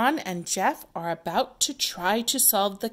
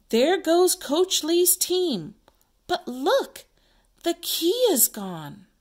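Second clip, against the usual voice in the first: high, loud, excited announcement; big read-aloud reveal; dramatic narrator; 9 semitones higher, 6 dB louder than usual. Acted. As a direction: call it out in amazement, pitched up. Narrator announces vanished key.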